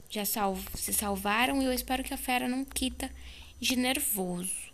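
ordinary talk

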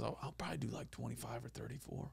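very soft, throwaway quote; offhand. Retold or imitated. Host retelling a club owner's casual plan, offhand. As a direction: toss it off under your breath.

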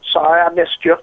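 ordinary talk, nothing marked

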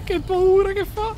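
scared. Real, not acted. Mostro spaventoso.

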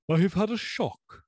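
high, strained voice of a doomed man; a shaken doctor near death; shaken old doctor. Acted. Dying doctor confesses his shock.

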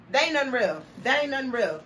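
usual speaking voice

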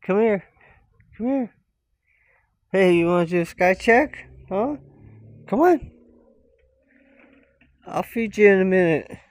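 high, loud coaxing pet voice. Real, not acted. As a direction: coax the cat to come along. Coaxing the cat.

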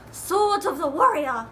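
hammed-up British accent to hype the book; bad British accent. Hyping a tiny book in a bad British accent. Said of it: "in a really bad British accent".

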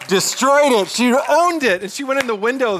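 loud, high-pitched burst of praise; excited boast. Real, not acted. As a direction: burst out with proud emphasis. Boasting about mom's brave flight.

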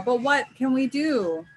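high, loud, drawn-out plea; crying out. A narrator's reading. Hornets asking Anansi what to do, crying out slowly. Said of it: "cried Mabaro".

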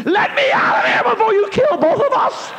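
high, loud panicked yell; panicked shout. Retold or imitated. The terrified gunman yelling at the driver, panicked shout. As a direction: scream to be let out.

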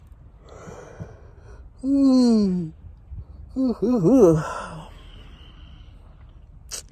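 a long, loud exhausted groan-sigh; long weary sigh. Real, not acted. Stuck waiting at the dealership, loud weary sigh. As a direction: let the boredom out in one drawn sigh. Bored waiting in mom's car.

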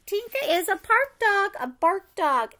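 ordinary delivery, nothing marked

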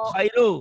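khairu with a strong guttural kh; kha keluarin. Shown as a demonstration. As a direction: push the kh of khairu out from the throat. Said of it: "Kha-nya keluarin". (kha-nya dikeluarkan dari tenggorokan)

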